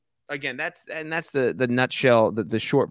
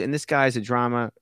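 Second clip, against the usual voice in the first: usual speaking voice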